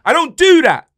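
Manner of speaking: loud, high, hammer the word do; indignant shout. Real, not acted. Fan shouting at his mate that he won't praise other clubs, indignant. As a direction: bellow it in protest.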